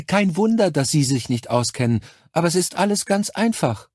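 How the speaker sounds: high, saccharine nurse voice; Krankenschwester, übertrieben herzlich. A narrator's reading. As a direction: talk down to the caller with sugary cordiality. (hohe, aufgesetzt herzliche Schwesternstimme)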